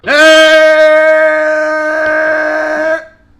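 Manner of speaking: very loud, high sustained scream; long scream. Real, not acted. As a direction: scream one endless note.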